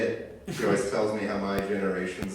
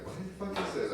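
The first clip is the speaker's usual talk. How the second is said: much higher, hushed female impression; baffled girlfriend; 10 semitones higher, 8 dB quieter, much faster than usual. Girlfriend reacting to his slang, high quiet bafflement. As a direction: go high and soft in mock bafflement.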